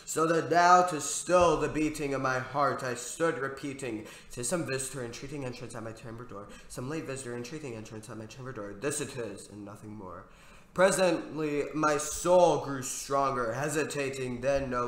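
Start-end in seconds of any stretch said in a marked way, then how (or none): 4.3-10.2 soft, quick murmur to steady himself; hushed nervous murmur. A narrator's reading. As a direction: rattle it off under the breath, softly and fast.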